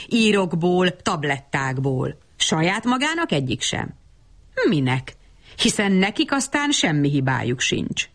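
ordinary delivery, nothing marked

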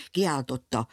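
ordinary delivery

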